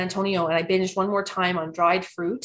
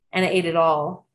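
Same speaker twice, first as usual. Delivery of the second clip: normal talking voice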